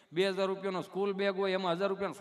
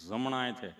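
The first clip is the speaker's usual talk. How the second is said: low stammering patient; tongue-tied Gabho; 7 semitones lower than usual. Retold or imitated. Gabho answering the nurse, low and halting. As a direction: catch on the first sound, answer low. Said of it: "ચોંટે અટકે".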